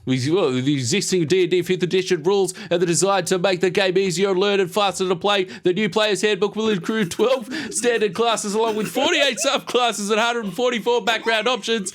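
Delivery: fast, rising hyped ad announcer; ad read voice. Pretend. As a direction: sell it like a liquor store ad. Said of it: "You sound like a fucking Dan Murphy's ad".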